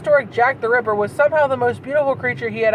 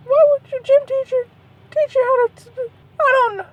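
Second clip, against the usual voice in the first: much higher pitched, disbelieving whine; incredulous; 12 semitones higher than usual. Real, not acted. Reading fan fiction aloud, appalled by the gym teacher, squealing in disbelief. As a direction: squeal it out in disbelief, half crying.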